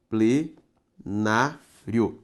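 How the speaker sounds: plenário drawn out very slowly, syllable by syllable; teacher breaking the word apart for students; syllables pulled apart. Shown as a demonstration. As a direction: split plenário into slow syllables. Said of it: "Separe comigo".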